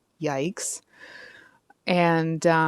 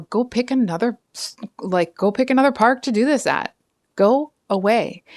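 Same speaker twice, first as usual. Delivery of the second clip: higher, louder indignant dismissal; 5 semitones higher, 4 dB louder than usual. Acted. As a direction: send them packing, indignant on someone's behalf. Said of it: "I'm getting indignant for you".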